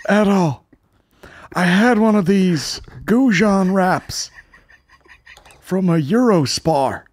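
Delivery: slow, high hammy American speech; mock American president. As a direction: speak like a folksy old president.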